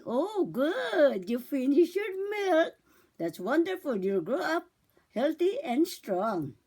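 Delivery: cooing, drawn-out praise; mother to baby. Shown as a demonstration. Mother praising baby for finishing the milk, swooping coo. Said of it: "Pag ubos na po yan".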